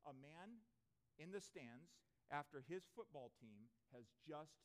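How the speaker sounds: usual speaking voice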